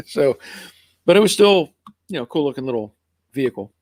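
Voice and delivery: ordinary talk, nothing marked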